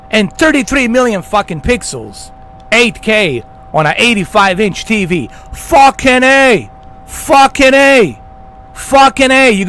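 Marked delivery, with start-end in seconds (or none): 5.5-9.5 slow, loud, drawn-out cry of disgust; loud exclamation. Real, not acted. Ranting at viewers about 8K hype, slow loud shout. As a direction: bellow it out slowly.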